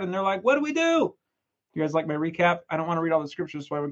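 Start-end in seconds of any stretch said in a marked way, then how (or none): 0.4-1.2 panicked question. Acted. Frightened men asking.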